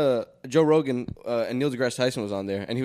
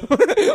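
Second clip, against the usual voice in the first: shrill burst of laughter, much higher; loud high laugh; high shrieking laugh; 7 dB louder than usual. Real, not acted. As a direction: squeal with laughter.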